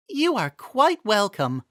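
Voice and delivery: put-on a young noblewoman shrugging off pain; weary Miranda. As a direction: Miranda reassuring Impa after her fall, weary. Miranda voiced in story.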